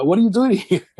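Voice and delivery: mock surprised Crush. Crush teasing him in the hotel lobby, mock surprised. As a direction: fake shock at finding him there.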